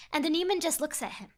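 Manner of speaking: plain reading voice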